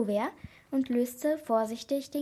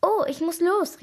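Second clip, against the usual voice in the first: higher, louder, abrupt realization; sudden exclamation; 6 semitones higher, 5 dB louder than usual. A narrator's reading. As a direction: call out as if suddenly remembering. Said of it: "rief Katharina plötzlich". (plötzlich ausrufen)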